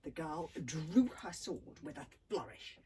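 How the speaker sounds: ordinary delivery, nothing marked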